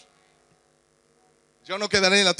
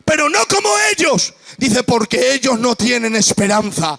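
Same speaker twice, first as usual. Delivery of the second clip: much higher and louder, shouted and half-sung; full-voiced Pentecostal climax; fiery preaching; 8 semitones higher, 6 dB louder than usual. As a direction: cry it out to the congregation.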